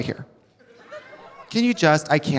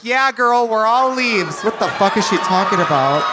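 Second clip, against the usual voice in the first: fans' reaction; 4 semitones higher, 5 dB louder than usual. Retold or imitated. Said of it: "and you guys are like".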